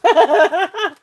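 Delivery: high burst of giggles. Real, not acted. Seller giggling at her own Southern twang. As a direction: laugh at herself, high and bright.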